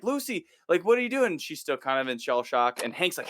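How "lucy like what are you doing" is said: high, urgent, confused; higher alarmed question. Retold or imitated. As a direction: question her as if she's lost it. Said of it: "he's like".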